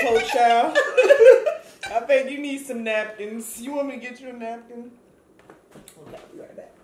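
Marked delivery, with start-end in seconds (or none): 0.0-0.8 loud laughing pity. Real, not acted. Laughing at friend crying. 1.9-4.9 laughing. Real, not acted. Laughing at friend crying.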